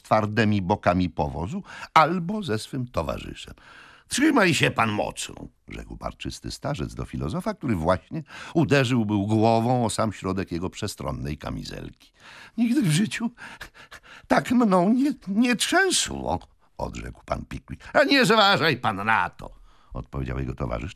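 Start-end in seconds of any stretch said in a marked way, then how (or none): 4.1-5.3 put-on hearty old gentleman. A narrator's reading. Wardle warns jolted companion. 12.6-16.4 high, broken-up complaint; halting, high-pitched protest; jolted Pickwick. A narrator's reading. Pickwick jolted in racing carriage. 17.9-19.6 put-on hearty old gentleman. A narrator's reading. Wardle brushes off complaint.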